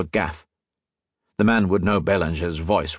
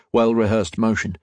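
plain reading voice

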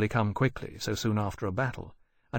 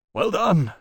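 praising; 7 semitones higher, 6 dB louder than usual. A narrator's reading. Captain praises boy.

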